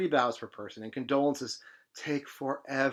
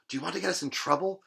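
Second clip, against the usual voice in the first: squeaks; 6 semitones higher, faster than usual. Acted. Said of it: "she squeaks".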